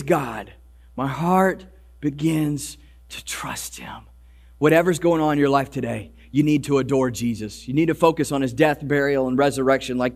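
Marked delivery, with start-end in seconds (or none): 0.0-4.0 hushed confession. Acted. Voicing author's quiet trust.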